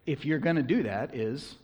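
ordinary delivery

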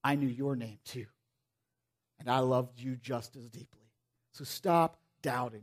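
Jesus gently telling Thomas he is loved, hushed; tender plea. Retold or imitated. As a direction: lovingly urge him to stop doubting. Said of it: "what he was really saying to Thomas".

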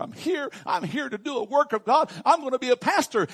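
high, loud, proud declaration; eager announcement. Retold or imitated. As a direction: retell it as an eager young pastor proclaiming. Retells his eager announcement to landlady.

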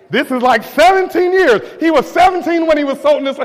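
high, shouted sermon climax; full-force preaching. Real, not acted. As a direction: preach at the top of your voice, driving each phrase home.

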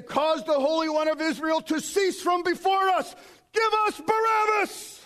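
defiant crowd cry. Acted. People demanding God be taken away, defiant high shouting. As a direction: cry out high, peak on the last phrase.

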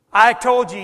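sudden loud retort; acted irritated denial. Peter denying he knows Jesus, loud and testy. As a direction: burst out loudly in denial.

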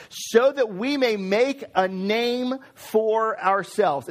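each word laid down slowly for weight; slow and deliberate key line; slow, weighty emphasis. Shown as a demonstration. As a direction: read it slowly, stressing every word. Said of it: "look at this line here".

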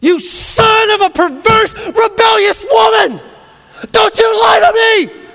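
full-throated enraged royal outburst; furious shout. Acted. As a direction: scream it at your son in fury. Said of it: "Saul snapped".

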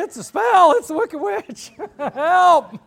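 hammed-up high, panicked yelling; Pete. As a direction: scream a frantic warning, comically overdone. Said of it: "you need Pete to yell to you".

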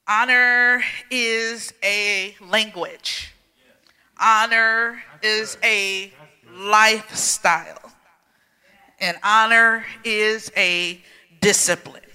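slow, drawn-out honor lines; preaching declaration. Acted. Pastor declaring to the church what honor is, slow preaching. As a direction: preach each word, slowly.

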